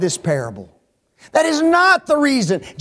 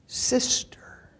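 whispered reveal; 4 semitones higher, 9 dB quieter than usual. Real, not acted. Preacher to his flock, a hushed whispered sister.

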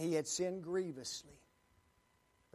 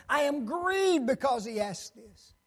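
louder, pained Peter pleading; upset Peter; 6 semitones higher, 9 dB louder than usual. Acted. Preacher voicing Peter as he is upset. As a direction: voice Peter's hurt, pressing hard. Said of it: "Peter was visibly upset".

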